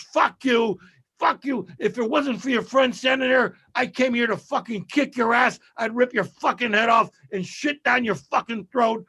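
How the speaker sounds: high-pitched, cursing mock tirade; retold furious rant; angry threats. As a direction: imitate a guy spitting mad and threatening. Retelling guy's threats to Otto.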